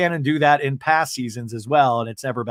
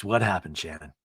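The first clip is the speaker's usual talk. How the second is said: mock gentle; 9 semitones lower, 6 dB quieter than usual. [name] gently asks.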